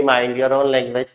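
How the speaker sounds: soft, slow and polite mind your own language. Shown as a demonstration. Teacher showing students the polite way they should not say it. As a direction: say it politely, almost gently. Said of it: "तो ऐसा polite नहीं".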